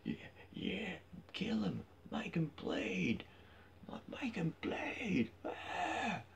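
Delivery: high, slow, breathy whispered chant of a crazed fan; mock the cocaine-eyed crowd egging on blood; mocking bloodthirsty fan. Mocking UFC fans in the audience, high whispered frenzy. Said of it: "you don't have to be like".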